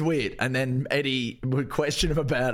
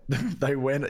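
ordinary delivery

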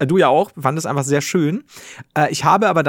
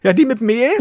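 nephew's voice; 7 semitones higher, 5 dB louder than usual. Retold or imitated. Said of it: "Und er so".

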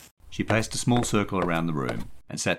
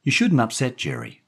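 high, louder woman's caution; warning; 9 semitones higher, 5 dB louder than usual. A narrator's reading. Daphne warning the boy about Jerry, warning. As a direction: caution him in a raised voice. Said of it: "Daphne warned Stevie".

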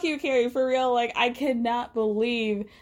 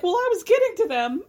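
lightly voiced sheepish excuse, higher and a touch louder; 7 semitones higher, 4 dB louder than usual. The comic caught out by Miranda, sheepish excuse. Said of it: "Che was like".

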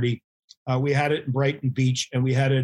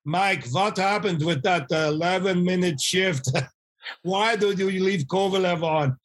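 put-on Russian accent; 4 semitones higher than usual. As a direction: put on Kovalev's Russian accent, laughing through it. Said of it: "in his Russian accent".

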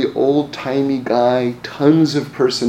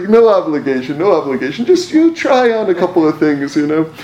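laughing; 4 semitones higher, 3 dB louder than usual. Retold or imitated. Retelling salesman, laughing.

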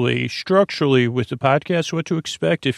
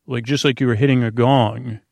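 usual speaking voice

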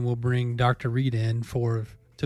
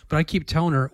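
ordinary talk

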